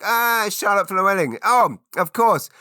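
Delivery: big exaggerated "ah, of course!" reaction; listeners' delighted recognition. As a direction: imitate fans exclaiming as it dawns on them. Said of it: "listeners to this podcast, like".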